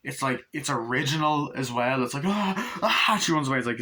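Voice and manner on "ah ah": high imitated cry of terror; mock scream. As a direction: yelp like a fleeing victim. Imitates film victim fleeing.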